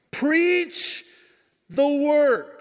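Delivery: high, loud proclamation with a pause; preacher's shout. Shown as a demonstration. Pastor repeating the job description, high forceful shout. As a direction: shout the command in two bursts.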